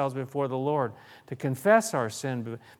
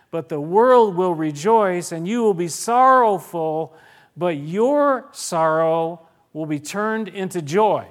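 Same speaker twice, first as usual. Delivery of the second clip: forceful scripture reading; 3 semitones higher, 8 dB louder than usual. Acted. Preacher reads scripture with force.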